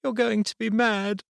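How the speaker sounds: put-on a scared girl fearing her father's anger; quivering little girl. A narrator's reading. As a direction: daughter quivering to her dad. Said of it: "she quivered".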